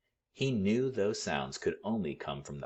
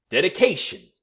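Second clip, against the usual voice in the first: proud; 13 semitones higher, 10 dB louder than usual. A narrator's reading. Big Chief proudly answers.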